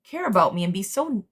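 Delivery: a hint of an incredulous, hurt partner; mock exasperated partner. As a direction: voice the girlfriend's hurt disbelief. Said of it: "And it's like".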